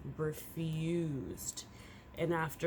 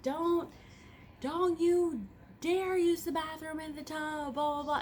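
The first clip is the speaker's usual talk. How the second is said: retold angry yell; 11 semitones higher than usual. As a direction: snap at the cats like you caught them in the act. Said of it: "I was yelling at them".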